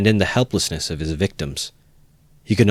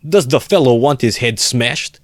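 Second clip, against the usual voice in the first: higher, slow threatening outburst; raised, drawn-out challenge; outraged cry; 5 semitones higher, 4 dB louder than usual. A narrator's reading. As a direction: leap in with an indignant threat. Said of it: "cried Razumikin".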